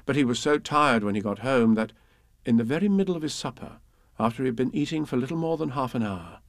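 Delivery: ordinary delivery, nothing marked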